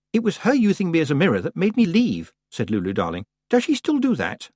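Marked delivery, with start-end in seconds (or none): 0.1-2.3 put-on raised girl's voice; young girl voice. A narrator's reading. Lulu voiced in story. 3.5-4.5 put-on high, curious girl; young girl voice. A narrator's reading. Lulu voiced in story.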